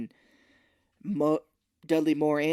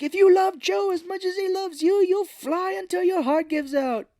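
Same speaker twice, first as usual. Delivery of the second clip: high, loud theatrical plea; melodramatic Santa; 6 dB louder than usual. Retold or imitated. Santa urging his reindeer on, high melodramatic plea. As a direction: declaim it like a rousing speech.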